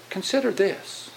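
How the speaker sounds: softly coaxing; gentle suggestion. Shown as a demonstration. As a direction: offer it gently, without pushing. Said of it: "Just go".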